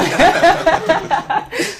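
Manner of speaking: high, bursting real laughter; host cracks up at the contestant's betrayal joke, laughing; fit of laughter. Real, not acted. Helpless giggle fit. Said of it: "Avec ce fou rire-là".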